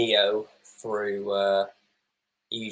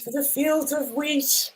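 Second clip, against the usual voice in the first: much higher, slow silly mock delivery; slower than usual. Viewer mocking the goddess scene, high slow silly voice. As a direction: send the line up as ridiculous.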